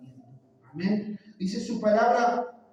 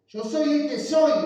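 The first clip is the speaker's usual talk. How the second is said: commanding, higher and louder; authoritative God voice; 6 semitones higher, 5 dB louder than usual. Acted. God answering Moses with commanding authority. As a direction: declare it as God with full authority. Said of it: "Como poniendo autoridad".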